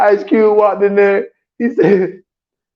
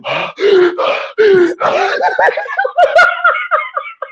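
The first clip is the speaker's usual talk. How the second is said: high gasping laugh. Retold or imitated. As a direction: gasp and break into laughter. Retold gasp with laugh.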